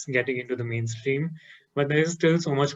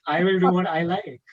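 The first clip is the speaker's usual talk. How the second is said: higher, louder sassy declaration; Pooja Mishra; 5 semitones higher, 6 dB louder than usual. Retold or imitated. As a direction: quote her, raised and defiant. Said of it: "Pooja Mishra says".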